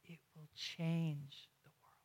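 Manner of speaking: slow, hushed whisper. Real, not acted. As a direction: let it fall to a whisper. Hushed sermon climax.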